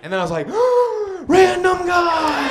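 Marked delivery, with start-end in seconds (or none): 0.5-1.2 loud high gasp of sudden realization; sharp gasp. Retold or imitated. Comic realizing who is calling, loud high gasp. 1.3-2.5 loud, high, slow cry of the name; alarmed realization. Retold or imitated. Comedian realizing who called, high slow alarmed cry.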